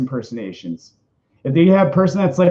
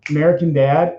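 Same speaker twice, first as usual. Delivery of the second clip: ordinary delivery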